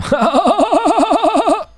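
high warbling cackle; alien laugh. Pretend. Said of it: "That's an alien laugh".

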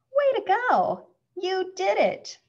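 high-pitched cheering; excited praise. A narrator's reading. Mom praises Schubert for getting dressed, excited. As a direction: cheer them on, pitch jumping up.

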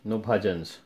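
usual speaking voice

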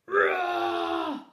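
huge loud high roar; monster roar. Acted. Monster roaring behind Bernard's mother, loud. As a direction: roar to scare.

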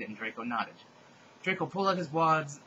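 ordinary talk

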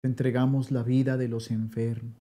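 normal talking voice

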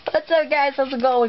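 high, bright intro greeting; excited intro voice. Acted. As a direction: hype up the viewers. Vlog intro greeting to viewers.

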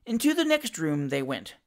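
ordinary delivery, nothing marked

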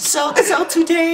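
high, slow mock British voice; British-like accent. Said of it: "It's like British".

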